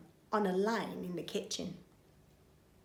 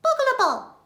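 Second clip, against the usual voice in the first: put-on very high, babbling baby; 8 dB louder than usual. Baby repeats the burglar's name, babbling. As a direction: squeak it like a toddler. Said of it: "says the baby".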